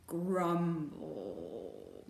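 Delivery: ordinary delivery, nothing marked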